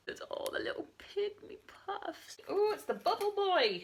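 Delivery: very high, cooing delight; delighted squeal. Real, not acted. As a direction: squeal over something tiny and cute. Delighted at spotting tiny figures.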